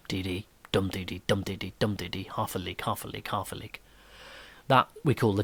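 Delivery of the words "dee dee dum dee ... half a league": "dum" stressed, "dee dee" light, then "half a league" the same; dactyl beat, softer. Shown as a demonstration. Teacher quietly repeating the dactyl rhythm for the class. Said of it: "one stress, dum, and two unstressed beats".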